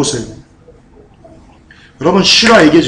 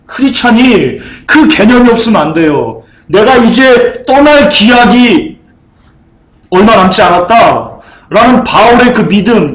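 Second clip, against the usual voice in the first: higher-pitched, emphatic sermon delivery; full-force preaching; 7 semitones higher, 4 dB louder than usual. Real, not acted. Pastor urging the congregation to live ready to leave this world, impassioned preaching. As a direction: preach it with rising urgency.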